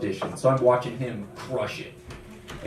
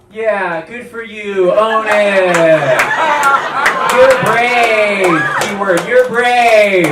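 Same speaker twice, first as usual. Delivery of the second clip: loud, slow, over-the-top supportive praise; mock encouraging friends; 8 semitones higher, 13 dB louder, much slower than usual. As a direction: play the friend hyping you up with fake cheer. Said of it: "your friends are like".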